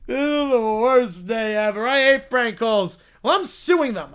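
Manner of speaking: put-on slow, drawn-out childish complaint; sulking child. Child after prank calls, indignant whining. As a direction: drag it out in a loud sulk.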